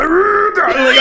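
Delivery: normal talking voice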